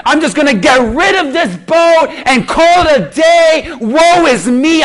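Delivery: playing the martyr over a broken boat, half laughing; hammed-up despair.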